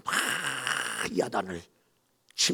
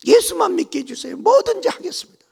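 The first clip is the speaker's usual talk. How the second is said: much higher, louder crying plea; desperate pleading; 12 dB louder than usual. Retold or imitated. Bride begging her mother-in-law to let her believe, desperately. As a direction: beg with all your heart.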